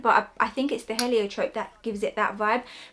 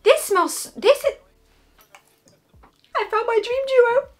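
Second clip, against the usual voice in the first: high, loud squeal of delight; thrilled; 12 semitones higher, 6 dB louder than usual. Real, not acted. Vlogger gushing to viewers about her dream perfume pair. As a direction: gush like you just struck gold.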